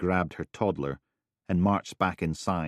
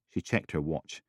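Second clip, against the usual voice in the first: usual speaking voice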